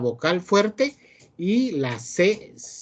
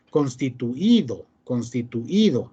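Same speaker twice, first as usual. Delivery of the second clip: "Constituido" said slowly, leaning on the i; stress on the I; 3 semitones lower than usual. Shown as a demonstration. Teacher shows students the tonic vowel, stressed I in "constituido". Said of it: "hay un poquito de fuerza en la I".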